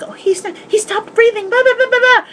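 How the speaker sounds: mock the panicked girl, high and quick.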